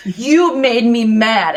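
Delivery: slow, drawn-out sulk; slow sulky guard. Retold or imitated. Imitating the guard who was interrupted, slow and sulky. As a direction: drag it out like a pouting man. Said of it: "he looks off like this".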